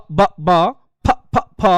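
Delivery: ব, ব then ফ, ফ, ফ with strong puffs of air; aspiration drill. Shown as a demonstration. Teacher drilling aspirated sounds for the class. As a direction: contrast ব with a breathy ফ. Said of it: "এরকম ভাবে পড়তে হয়".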